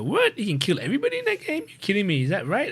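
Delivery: high, incredulous What?; shocked disbelief. Retold or imitated. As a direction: gasp of disbelief, pitch shooting up. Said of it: "I go".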